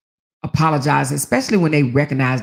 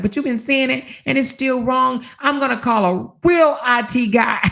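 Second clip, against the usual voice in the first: high, raised mock shout at husband; yelling back, pitched way up; retold shout; 8 semitones higher than usual. Arguing with husband over computer, raised voice.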